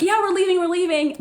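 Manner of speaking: put-on teen calling to police behind the door, high and hurried. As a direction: call out that you're leaving.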